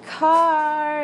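high, drawn-out warning call. Retold or imitated. Street hockey kids warning of traffic, calling out. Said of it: "they're all like".

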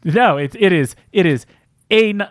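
usual speaking voice

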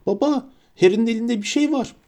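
put-on high-pitched tattling child; calling out. A narrator's reading. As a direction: call Dad's attention in a high voice. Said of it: "diye seslendi ansızın".

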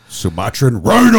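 loud announcer shout; monster truck ad. Retold or imitated. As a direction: bellow it like a monster truck promo. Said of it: "It's like the monster truck guy".

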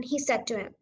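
usual speaking voice

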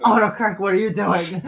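loud, high, baffled cry; exasperated disbelief. Retold or imitated. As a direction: shout at the phone in disbelief. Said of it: "I'm just like".

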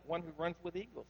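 softly savoring the name as if impressed; mock admiring. Shown as a demonstration. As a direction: say it like it sounds lovely. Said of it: "At first it sounds good, doesn't it?".

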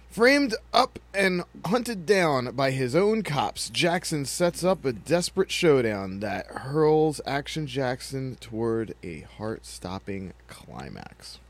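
slow, dramatic trailer announcer; booming trailer narrator read; movie trailer voice. Acted. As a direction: sell it like a cheesy movie trailer. Reads trailer blurb.

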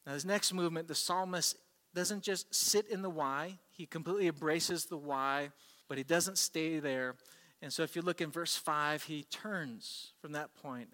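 ordinary talk, nothing marked

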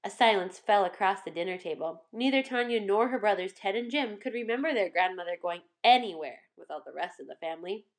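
ordinary delivery

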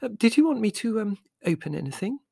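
high flustered twitter; nervous high fluttery reply; twittering Mother. A narrator's reading. Mother flustered answering the customs man, high twitter. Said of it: "twittered Mother".

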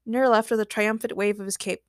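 plain reading voice

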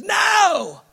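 sharp, high, loud "No!"; forceful no. Acted. Preacher voicing God refusing half-hearted vows, shouting. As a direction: cut them off with a shouted refusal.

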